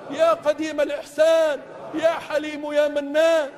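ordinary talk

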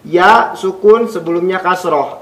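ordinary talk

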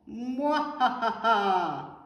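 loud, drawn-out mwahaha; hammed-up evil cackle; villain laugh. A narrator's reading.

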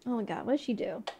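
ordinary talk

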